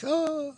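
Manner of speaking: high, put-on pained "oh"; mock hurt groan. Reviewer acts out an injured Bond, high mock groan. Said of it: "When Daniel Craig got hurt".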